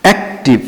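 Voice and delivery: অ্যাক্টিভ in two separate beats, অ্যাক্–টিভ; split into syllables. Shown as a demonstration. Teacher showing students the syllables, অ্যাক্টিভ split in two. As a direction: break অ্যাক্টিভ after অ্যাক্, then say টিভ. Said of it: "কয়টা syllable এখানে?".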